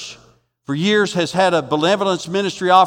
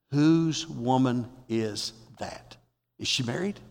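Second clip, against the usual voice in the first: soft, slow, lower curious asking; hushed curious question; 5 semitones lower, 10 dB quieter, slower than usual. Retold or imitated. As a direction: lean in and ask quietly. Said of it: "that's what he's asking".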